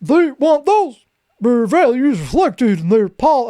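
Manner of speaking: high, put-on caricature voice; silly high mock-speech voice; mocking impersonation. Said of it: "he does not talk like that".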